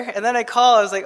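normal talking voice

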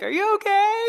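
high, lightly voiced worried wife. Husband voicing his wife asking if he's okay, high and concerned. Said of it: "my poor wife's like".

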